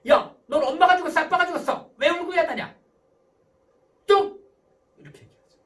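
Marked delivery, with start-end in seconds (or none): 0.1-4.5 loud, high angry telling-off; scolding parent. Acted. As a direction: shout down the crying child. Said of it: "찍소리마 스타일 어떻게 해요?". (꾸짖듯 높고 크게 호통치며)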